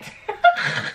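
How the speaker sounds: high-pitched burst of laughter; high cackling laugh; teasing laugh. Real, not acted. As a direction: crack up loudly at a friend. Laughing at her slow friend.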